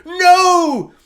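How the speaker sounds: hammed-up grief wail; horrified cry. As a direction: scream it in horror. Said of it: "watches on in horror".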